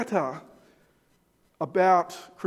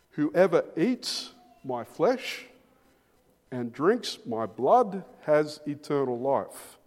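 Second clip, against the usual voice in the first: slow solemn reading; slower than usual. Acted. Reading scripture slowly.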